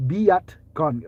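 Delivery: "bacon" slowly split as "BEE-at... kon"; syllable-split bacon. Shown as a demonstration. Spelling it out for viewers, "bacon" as slow "bee-at-kon". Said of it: "Like B E A".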